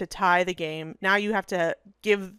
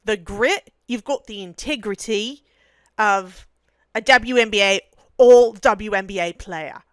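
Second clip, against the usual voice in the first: put-on Australian accent; 6 dB louder than usual. Hyping up a rookie, playful Australian accent. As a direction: praise the young star in a mock Australian accent. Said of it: "we're doing it in an Australian accent".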